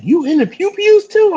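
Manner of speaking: very high, loud amazed question; imitating an astonished fan. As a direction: ask it amazed.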